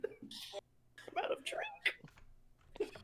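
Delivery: breathy unvoiced whisper. Whispered aside.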